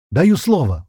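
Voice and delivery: shouted promise. A narrator's reading. Sazonov holds out his hand to Zhenka, shouting. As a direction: cry out your word of honour. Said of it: "выкрикнул Сазонов".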